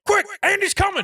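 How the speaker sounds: high-pitched urgent toy soldier alarm. Acted. Toy soldiers warn each other that Andy is near, high urgent call. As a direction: play a little soldier sounding the warning.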